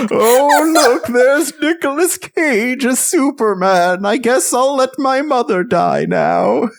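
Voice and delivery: put-on high slow quavery old man; shaky old voice. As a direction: drag it out like a dazed old man. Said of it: "the old man voice".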